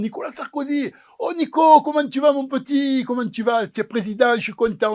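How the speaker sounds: put-on high, excited hello to an old friend; Léon greets his childhood friend the president, overjoyed; joyful greeting. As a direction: gush over him like a long-lost pal.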